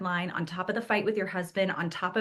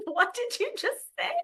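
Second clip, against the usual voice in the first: much higher, incredulous question; shocked mom; 13 semitones higher than usual. Retold or imitated. Mom catching her son swearing, high and incredulous. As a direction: ask it half-laughing in disbelief. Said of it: "I said".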